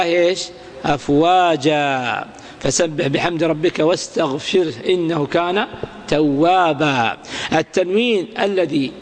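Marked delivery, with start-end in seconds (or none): 0.8-2.2 أفواجا ending in long "-aa", no n; slow, low أفواجا with the last vowel drawn out; pausal alif. Shown as a demonstration. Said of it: "اجعل ألفا". 6.1-7.2 توابا ending in long "-aa", no n; slow, low توابا, holding the final vowel; pausal alif. Shown as a demonstration. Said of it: "بالألف".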